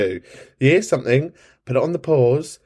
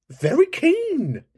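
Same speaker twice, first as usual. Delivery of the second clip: very high, slow drawn-out tease; drawn-out teasing; much slower than usual. Pretend. Host teasing an eager caller, very high and slow. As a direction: stretch it out, way up high.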